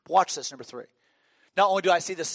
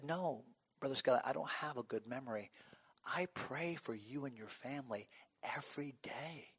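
very soft, lowered, heartfelt voice; hushed sincerity; 3 semitones lower, 14 dB quieter than usual. Retold or imitated. Retold heartfelt reply of Brother Vic.

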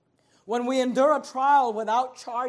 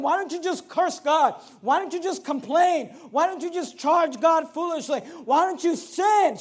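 higher, louder goading questions; urging temptation; 5 semitones higher, 5 dB louder than usual. Retold or imitated. Preacher voicing Job's wife tempting him to sin, insistent urging. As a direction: press each question harder, as a tempter voiced by a preacher.

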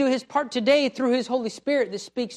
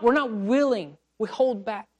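ordinary talk, nothing marked

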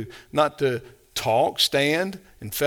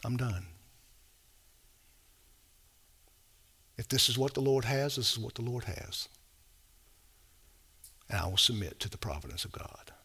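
much softer, drained and hushed, ending in a whisper; quietly retelling the moment he could cry no more, softly; exhausted hush; 5 semitones lower, 13 dB quieter, faster than usual. Retold or imitated. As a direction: let the voice fade to nothing, out of tears. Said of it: "coming to a place where I said".

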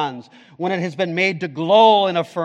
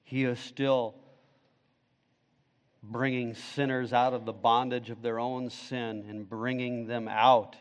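prayer; 6 semitones lower, 10 dB quieter than usual. Acted. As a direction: pray quietly before God. Pastor leads closing prayer.

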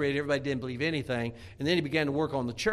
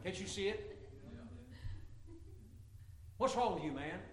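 a softer, needling wife's voice; mock Jezebel; 7 semitones higher, 13 dB quieter than usual. Preacher voicing Jezebel scolding sulking Ahab, mock needling. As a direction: chide him like a scornful wife. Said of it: "This is how I picture her when she walks in his room".

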